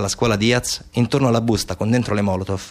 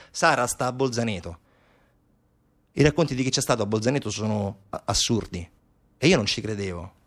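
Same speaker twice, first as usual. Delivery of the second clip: softer, subdued telling; hushed grave narration; 3 semitones lower, 6 dB quieter than usual. Acted. Narrator reveals Bolzaneto horrors, hushed and grave. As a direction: drop to a low-key, sober quiet.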